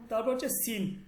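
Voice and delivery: ordinary talk, nothing marked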